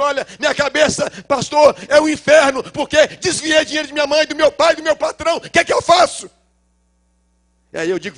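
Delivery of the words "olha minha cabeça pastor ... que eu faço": loud, high anguished confession; desperate plea. Pretend. Troubled young man pleading with his pastor. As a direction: beg the pastor for help.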